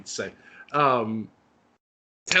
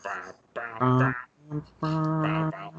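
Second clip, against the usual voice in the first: imitating a horn; slower than usual. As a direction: mimic the entrance horn with your voice. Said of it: "that familiar horn".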